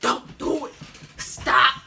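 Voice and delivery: urgent hushed stop, stop; bus monitor pleading. Retold or imitated. Bus monitor telling the girls to stop, urgent plea. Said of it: "She was like".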